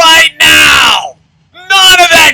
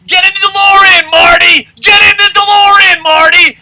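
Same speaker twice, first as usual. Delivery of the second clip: higher, louder urgent yell; yelled movie quote; 6 semitones higher, 4 dB louder than usual. Retold or imitated. Ranter yelling the DeLorean line at Marty, urgent. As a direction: shout it like a panicked scientist.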